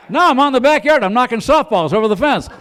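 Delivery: high, loud, laughing boast; excited boasting. Pretend. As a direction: crow about it gleefully.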